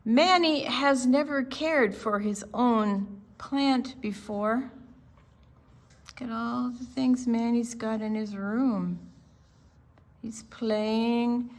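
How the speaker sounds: usual speaking voice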